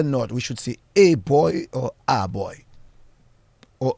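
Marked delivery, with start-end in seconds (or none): 0.9-1.7 put-on "a boy" with "a" said like its letter name; ay pronunciation. Shown as a demonstration. Host asks doctor which is proper, ay pronunciation. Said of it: "a hard sound or". 2.1-2.6 put-on "a boy" with an open ah for "a"; ah pronunciation. Shown as a demonstration. Host asks doctor which is proper, ah pronunciation. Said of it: "a soft sound".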